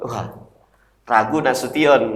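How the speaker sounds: ordinary talk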